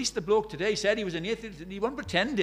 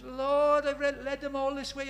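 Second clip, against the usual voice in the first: higher, half-acted despairing prayer; a touch of a weeping missionary; retold tearful plea; 5 semitones higher, 3 dB louder than usual. Gladys at the flooded river, praying to the Lord, mildly tearful. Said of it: "she just starts to cry".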